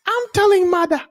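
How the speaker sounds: very high whiny British brat; spoiled child tattling to mother; mock British accent. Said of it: "The accent".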